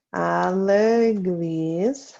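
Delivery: à l'église stretched out very slowly; slow dictation. Shown as a demonstration. Teacher to class writing the answer, very slow dictation. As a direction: draw out each syllable of "À l'église".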